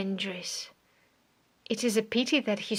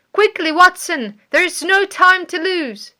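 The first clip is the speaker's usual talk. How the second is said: loud, higher urgent command; 8 semitones higher, 10 dB louder than usual. A narrator's reading. Holmes urges Watson to run toward the scream, urgent loud call. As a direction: hurry your friend along, loud.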